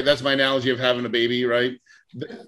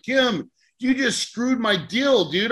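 exasperated; 7 semitones higher, slower than usual. Retold or imitated. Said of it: "I'm like".

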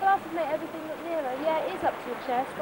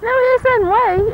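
shouting; 8 semitones higher, 15 dB louder, much slower than usual. Real, not acted. Family squabble over the camera.

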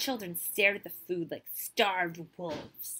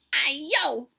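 higher, louder exclamation; crying out; 6 semitones higher, 6 dB louder than usual. A narrator's reading. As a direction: cry out in a scramble. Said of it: "they cried".

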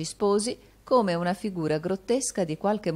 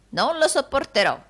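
loud, higher outburst; defiant; 8 semitones higher, 6 dB louder than usual. A narrator's reading. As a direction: refuse outright, indignant old servant. Housekeeper defies her master.

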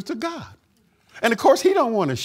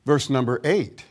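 normal talking voice

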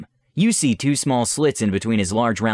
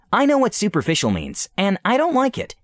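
high, whiny protest; pouty protest; 10 semitones higher than usual. Acted. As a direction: stamp your foot like a spoiled princess. Solana protests, high-pitched.

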